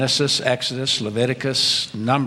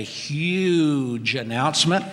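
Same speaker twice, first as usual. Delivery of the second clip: "huge" stretched out long for emphasis; stress on huge; 3 semitones higher, slower than usual. Real, not acted. Pastor announcing the search team's news, heavy stress on "huge". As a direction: lean hard and long on "huge". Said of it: "I put the emphasis on huge".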